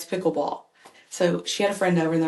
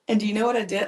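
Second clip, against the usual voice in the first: ordinary delivery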